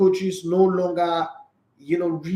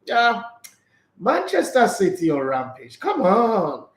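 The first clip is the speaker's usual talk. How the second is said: ordinary talk, nothing marked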